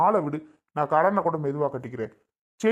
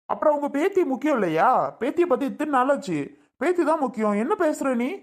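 higher, sharp angry comeback; heated retort; 6 semitones higher than usual. Acted. Husband snaps back at his wife over the chain, higher and heated. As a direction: fire back at her, pitch up.

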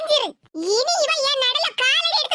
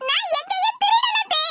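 desperate plea; 8 semitones higher than usual. Acted. Accused daughter-in-law pleads, shouting.

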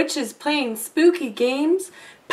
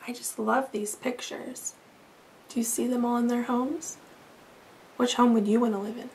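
much softer chatty aside; 8 dB quieter, faster than usual. Real, not acted. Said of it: "aside to children".

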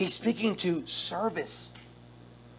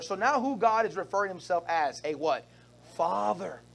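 ordinary talk

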